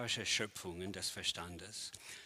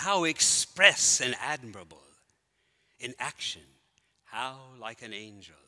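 declaimed Shakespeare, swelling louder then fading to a hush; Hamlet recitation; 5 dB louder than usual. Acted. Lecturer performing Hamlet for the audience, grand to hushed. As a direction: build the speech up, then let it die away. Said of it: "in Hamlet gefeiert".

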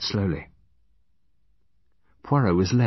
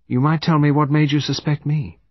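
higher-pitched, casual curiosity; conversational; 8 semitones higher, 6 dB louder than usual. A narrator's reading. Murderess asks the detective about his suspicions, conversational. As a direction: ask it as polite small talk. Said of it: "in a conversational tone".

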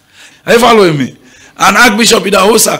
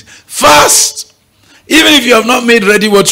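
exhorting shout; 6 semitones higher than usual. Acted. Preacher urging the congregation to fast. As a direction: punch it as a command.

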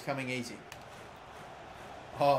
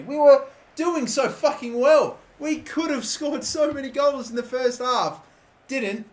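higher, pained disbelief at the draw; anguished lament; 9 semitones higher, 6 dB louder, slower than usual. Real, not acted. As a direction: cry out at what was lost. Late draw ruins the game.

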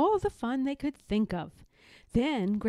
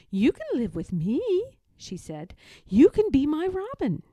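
higher, louder happy offer; eager little cub; 6 semitones higher, 6 dB louder than usual. A narrator's reading. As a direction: welcome the bird home warmly. Cub adopting robin.

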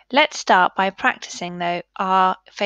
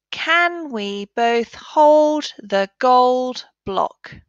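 the sentence read smoothly, slow and a little higher; whole sentence; 4 semitones higher, much slower than usual. Shown as a demonstration. Teacher modelling the blended sentence for pupils. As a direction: read it all in one go. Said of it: "let's look at it together".

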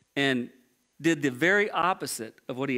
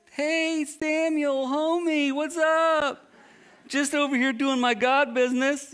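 high, bright mock-casual hello, far higher than his own voice; fake cheerful greeting; 10 semitones higher, slower than usual. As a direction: play it like a guilty guy faking a breezy welcome. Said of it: "So he fakes it".

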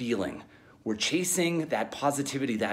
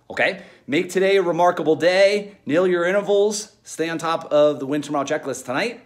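louder, higher rallying call; pumped-up coach; 6 semitones higher, 6 dB louder than usual. Real, not acted. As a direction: fire up the team to finish.

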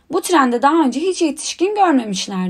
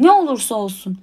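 plain reading voice

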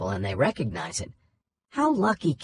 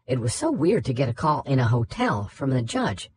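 ordinary delivery

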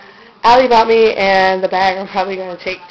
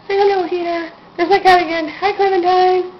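much higher, cutesy pet voice; 11 semitones higher than usual. Real, not acted. Baby talk to heater and cat.

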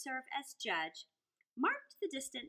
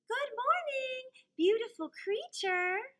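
high, slow, loud sugary greeting; butter up the crow; sly fox flattering; 11 semitones higher, 5 dB louder, slower than usual. Acted. Fox greeting the crow with flattery, sugary. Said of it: "he cried".

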